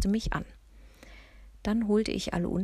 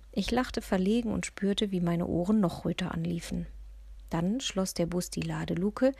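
usual speaking voice

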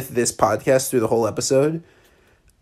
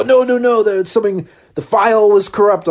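much higher and louder, fake panicky excuses; hurried excuse; 8 semitones higher, 6 dB louder than usual. Hosts hurriedly claim the file was corrupt, flustered high voice. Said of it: "They go".

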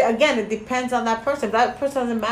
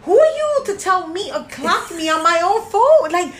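higher, louder indignant retort, climbing sharply on the last question; retold indignant snap; 8 semitones higher, 4 dB louder than usual. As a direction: replay the outrage of being called out. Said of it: "But I was so like".

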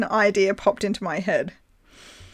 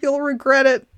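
pleading; 6 semitones higher than usual. Retold or imitated. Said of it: "I say".

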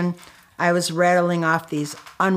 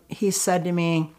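ordinary talk

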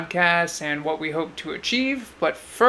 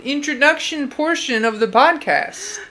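pitched-up insistence; defensive protest; 8 semitones higher, 3 dB louder than usual. Real, not acted. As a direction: protest that there is a plan. Husband defends his plan, protesting.

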